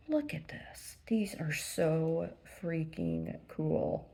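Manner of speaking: slow, hushed whisper; awed whisper. Real, not acted. Vlogger showing a gift, in an awed whisper. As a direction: whisper it in wonder. Showing calligraphy brushes to viewers.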